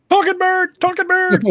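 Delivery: high, loud cry of panic; alarmed mock shout. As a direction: yell it out like a spooked witness. Acting first person hearing a parrot.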